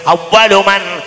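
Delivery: sermon with slow chanted Arabic; preaching at full force. Real, not acted. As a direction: rise to a ringing proclamation.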